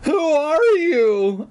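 high, slow, strained wail; choked out. A narrator's reading. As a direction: squeeze out a hammed-up frightened cry. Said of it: "I managed to choke out".

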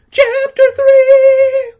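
piercing high, drawn-out shriek; screamed chapter title. Pretend. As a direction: announce the chapter in a hammed-up panic scream.

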